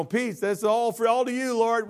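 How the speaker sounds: high, loud celebratory dedication; a touchdown player thanking heaven; football player dedication. Retold or imitated. Pastor mimicking players praising God after a touchdown. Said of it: "They're like this".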